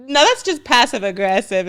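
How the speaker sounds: ordinary delivery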